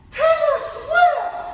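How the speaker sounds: very high, loud, long drawn-out mock yell; top-of-the-lungs yell. Teacher imitating a student yelling out in class, drawn-out high yell. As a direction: imitate someone bellowing a confident answer, stretching every word. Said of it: "Yelled out at the top of their lungs".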